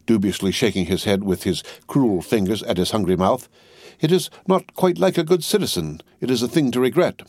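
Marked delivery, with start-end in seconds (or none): 4.0-7.2 mild croak. Acted. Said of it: "croaked Jacques three".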